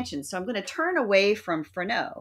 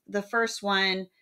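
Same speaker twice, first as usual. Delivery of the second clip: ordinary delivery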